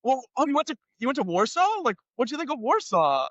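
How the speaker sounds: high, chatty imitation of the cop; mock-cheerful police officer; cop's voice. Cop voiced flipping through passport.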